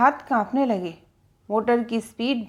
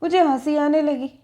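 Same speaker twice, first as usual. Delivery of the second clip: usual speaking voice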